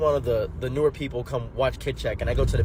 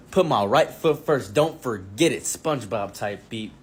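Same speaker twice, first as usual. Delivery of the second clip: ordinary talk, nothing marked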